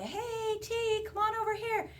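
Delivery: put-on high-pitched call to a little kid; somebody calling out. Storyteller voices someone calling little T, calling out. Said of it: "somebody would say".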